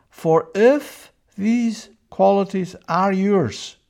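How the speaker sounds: high, loud and slow, every word pressed; emphatic verse quote. Acted. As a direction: preach the verse out, slow and big.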